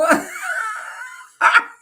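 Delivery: high whooping laugh bursting out. Real, not acted. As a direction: let out a big shrill cackle of delight. Laughing after a shouted joke.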